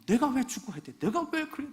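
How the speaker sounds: high, loud resentful cry; bitter complaint. Retold or imitated. Dying girl protesting her death, high and resentful. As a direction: voice the girl railing at her fate. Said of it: "막 원망하고".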